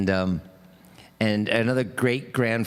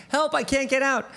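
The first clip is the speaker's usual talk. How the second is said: sustained high cry for help; mock scream; 12 semitones higher, faster than usual. Trapped man calls up once more from the well, mock screaming. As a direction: call high out of a well, without real volume. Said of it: "hears him screaming".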